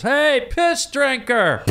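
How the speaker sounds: high, slow mocking call; shouted taunt. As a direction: call it out across the room. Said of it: "some lady goes".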